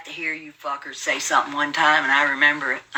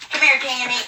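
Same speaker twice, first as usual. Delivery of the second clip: sing-song call to a pet; higher coaxing call to dog; 7 semitones higher than usual. Real, not acted. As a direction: lift the pitch and coax the dog over. Calling her dog again.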